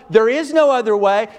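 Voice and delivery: loud, high and insistent; loud preaching. Acted. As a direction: hammer it home loudly. Same line later said quietly.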